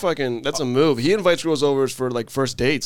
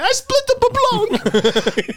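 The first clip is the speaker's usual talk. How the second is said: very high, slow squealed falsetto; drawn-out wail as a caricatured woman; mock-weeping date; 4 dB louder, much slower than usual. Date confessing her ruined sauce, mock wail in falsetto.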